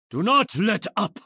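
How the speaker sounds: high, loud command to keep fighting; a hard-pressed archer barking over the fray; battle shout. Acted. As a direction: drive the allies on, full voice. Archer urging allies in battle.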